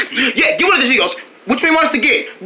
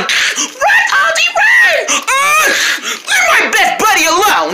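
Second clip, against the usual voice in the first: frantic shout; 12 semitones higher than usual. Acted. Buddy screaming at Aldi to flee, high frantic yell. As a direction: yell it up high like a panicked kid. Child cries to friend fleeing.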